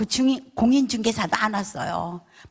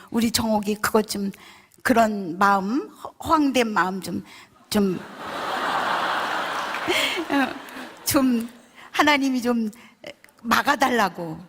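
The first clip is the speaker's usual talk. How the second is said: ordinary talk, nothing marked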